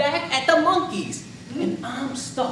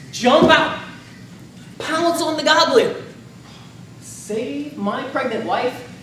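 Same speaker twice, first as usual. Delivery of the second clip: ordinary delivery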